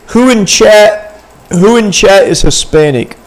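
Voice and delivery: calling out. Real, not acted. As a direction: call out loudly for a show of hands. Calling out to stream chat.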